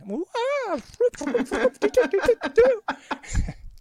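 very high, loud cartoon whooping; Curly. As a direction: burst into wild slapstick yelps and stutters. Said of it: "go into a full-on Curly".

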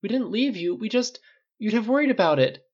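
high-pitched, upset protest; distressed. A narrator's reading. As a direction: blurt the defence in distress. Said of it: "Riley's head snapped up in distress".

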